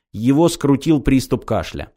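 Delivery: usual speaking voice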